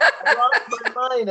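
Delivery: high-pitched exclamation through laughter; laughing exclamation. Retold or imitated. Parent repeating reaction to kid's Fortnite line, high laughing exclamation. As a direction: repeat the retold outburst, cracking up.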